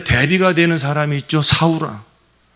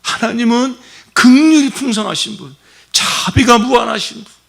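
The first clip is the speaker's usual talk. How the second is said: fervent praise; 9 semitones higher, 3 dB louder than usual. Real, not acted. Preacher exalts God's mercy.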